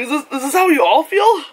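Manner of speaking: high incredulous asking; bemused question. Retold or imitated. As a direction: ask in mock disbelief. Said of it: "I'm like".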